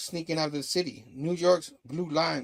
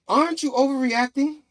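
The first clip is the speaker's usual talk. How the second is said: high, raised-voice challenge; exasperated protest; 10 semitones higher, 6 dB louder than usual. Retold or imitated. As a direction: protest it loudly to a partner. Overheard argument in another room.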